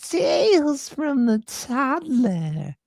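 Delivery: high, slow drawn-out horror host; mock Crypt Keeper intro; spooky announcer voice. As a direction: ham it up like a creepy TV host. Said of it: "terrible impersonation of Tales from the Crypt".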